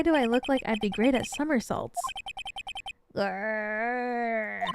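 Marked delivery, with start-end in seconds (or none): 3.2-4.6 tiger growl. Acted. Unseen tiger growls at Maya and Nick, growling.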